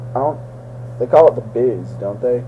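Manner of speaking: ordinary talk, nothing marked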